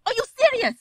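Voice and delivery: put-on indignant, high and loud in accent; Storm. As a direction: challenge them, incredulous and offended. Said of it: "she's just like".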